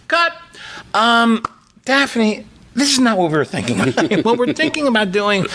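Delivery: high-pitched hesitant director giving notes; put-on cartoon director voice. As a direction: correct an actor as a pretend director, half laughing. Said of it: "what a director did for a cartoon".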